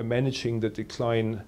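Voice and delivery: ordinary delivery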